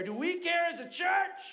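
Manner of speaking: high, loud shout from the pulpit; preaching shout. Acted. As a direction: hammer the question home to the church. Preacher's rising rhetorical climax.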